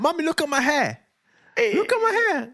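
put-on high-pitched kid showing off to Mummy; high, pleased small-child voice; excited little boy. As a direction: imitate a child calling for attention. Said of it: "And he went to Mummy like".

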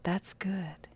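abbot's gentle unbothered reply; serene whisper. Retold or imitated. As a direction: say it with a knowing smile. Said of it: "smiled and was often known to say".

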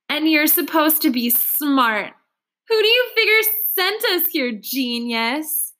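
mock him cruelly. A narrator's reading. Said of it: "Aaron laughs cruelly".

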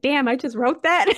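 acting out a writer impressed with themselves; playful self-amazement. Voices writer amazed at own work.

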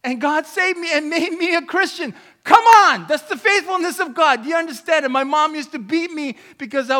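loud, high, triumphant proclamation; impassioned preaching. Real, not acted. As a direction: rouse the congregation with force. Preaching at full force.

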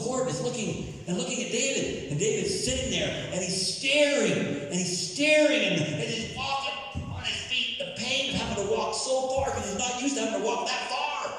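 high-pitched, impassioned storytelling sermon; excited preacher acting out the scene; preaching at full force. Real, not acted. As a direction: preach it high and urgent, building suspense. Preacher dramatizing a Bible scene.

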